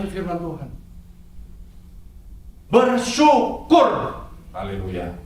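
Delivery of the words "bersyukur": "Bersyukur" ditarik panjang, tinggi dan lambat; seruan berkhotbah. Acted. Pengkhotbah menyerukan kata kunci. (pengkhotbah menyerukan "Bersyukur" kepada jemaat, lambat dan tinggi)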